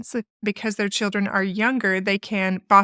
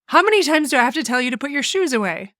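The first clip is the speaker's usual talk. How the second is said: exasperated nagging; 4 semitones higher, faster than usual. Retold or imitated. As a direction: rattle it off like a fed-up parent nagging. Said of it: "in this exasperated tone or irritation".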